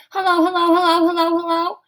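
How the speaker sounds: high, loud singsong taunt; teasing chant of hellos. A narrator's reading. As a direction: needle him with each hello. Lori taunting Jeff.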